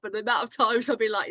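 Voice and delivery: ordinary talk, nothing marked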